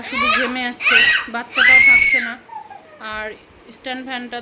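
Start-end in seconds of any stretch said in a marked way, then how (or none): 0.0-2.4 laughing cry. Real, not acted. Mother laughing in exasperation at the fussing child in the heat.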